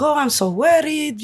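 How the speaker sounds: lightly voiced Cuban-accented worried mom; a hint of a fretting Cuban mother. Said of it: "with her Cuban accent".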